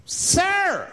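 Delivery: high-pitched outraged call; mock outrage. Passenger objecting to a cigarette on the bus, mock outrage. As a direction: call out sharply as a scandalized passenger.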